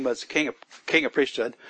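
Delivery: usual speaking voice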